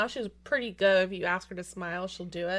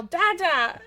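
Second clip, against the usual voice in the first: high, loud imitation of a calling child; mock toddler yell; 8 semitones higher, 5 dB louder than usual. Parent imitates daughter summoning Dad to watch coloring, mock yell. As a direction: call out like a toddler wanting Dad. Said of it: "then she'd yell".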